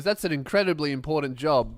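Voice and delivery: ordinary delivery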